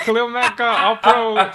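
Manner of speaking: loud, high, drawn-out boast through laughter; laughing retort. Retold or imitated. Retelling a comeback to a rival player, laughing loudly. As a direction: crow it slowly while cracking up.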